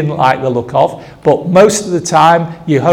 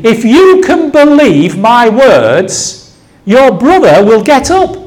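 emphatic promise, no platitude; 5 semitones higher, 4 dB louder than usual. Acted. Jesus promising Martha her brother will get up, emphatic. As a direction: declare it as a literal, startling promise. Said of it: "But it wasn't a platitude".

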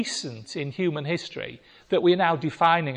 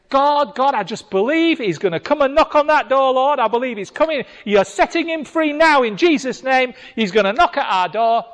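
fervent prayer; 6 semitones higher, 6 dB louder than usual. Pretend. Voicing believing prayer.

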